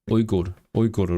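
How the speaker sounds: "Uyghur" read phonetically as "OOY-goor"; Czech reading. Shown as a demonstration. Streamer reading Czech spelling to chat, "Uyghur" as "OOY-goor". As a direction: sound out "Uyghur" letter by letter, opening "ooy". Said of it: "how do you say it in Czech".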